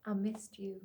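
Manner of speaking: very soft, hushed confession; tender murmur. Acted. Father held by his daughter, tender and hushed. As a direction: say it barely aloud.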